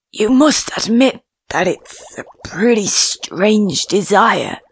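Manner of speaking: slow, lightly voiced old fish; an old fish apologising gently; kindly old fish. A narrator's reading.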